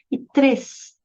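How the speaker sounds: "três" agudo, num fôlego só; uma só emissão. Shown as a demonstration. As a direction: diga "três" como sílaba única e destacada. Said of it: "numa só emissão de voz". ("três" inteiro, com tom mais alto)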